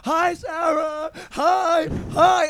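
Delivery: high, drawn-out mock shout; imitating belligerent drunk. Drunk friend butts into a phone call with a girl, belligerent mock greeting. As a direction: mimic a drunk heckler hollering. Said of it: "Zach is belligerent drunk".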